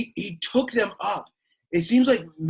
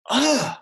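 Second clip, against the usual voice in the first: disgusted ugh; 5 semitones higher, 4 dB louder than usual. Acted. Disgusted reaction to the crowd.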